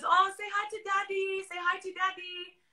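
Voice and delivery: high, sugary coaxing voice; sweet baby-talk mom. Retold or imitated. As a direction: coo it like a mother to a toddler. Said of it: "she goes".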